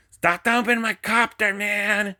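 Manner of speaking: high, loud exasperated shout; frustrated yell. Real, not acted. Pilot yelling at unseen ghosts shoving his new drone, frustrated shout. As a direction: yell your frustration at whoever keeps bumping it.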